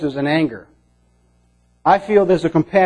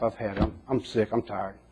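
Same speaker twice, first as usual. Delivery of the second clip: weary despair; 6 semitones lower, 12 dB quieter than usual. Acted. Despairing prophet asks to die.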